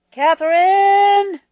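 long, high, full-volume call; bellow. A narrator's reading. Walter summoning Catherine to the phone, bellowing. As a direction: bellow a name through the house. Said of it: "bellowed Walter".

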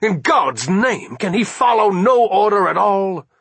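high, exasperated outburst; almost snarling. A narrator's reading. Commander rages at a subordinate ignoring orders, near snarl. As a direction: burst out angrily, climbing in pitch and force. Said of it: "almost snarled".

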